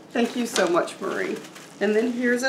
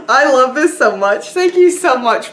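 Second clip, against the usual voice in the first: loud, high gush of thanks; elated gratitude; 4 semitones higher, 6 dB louder than usual. Real, not acted. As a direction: gush with real delight, climbing high. Thanking friends for treasured gift.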